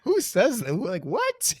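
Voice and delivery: pitched far up in disbelief; who says that, squeaked in disbelief; incredulous. Retold or imitated. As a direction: ask it stunned, high. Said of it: "I was like".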